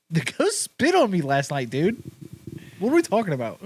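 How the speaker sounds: mock outraged retort. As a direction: argue back in a high, incredulous voice. Plays another sibling arguing back.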